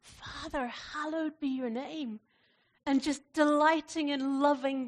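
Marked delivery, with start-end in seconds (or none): 0.0-2.2 tearful prayer. Retold or imitated. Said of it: "tears rolling down his face".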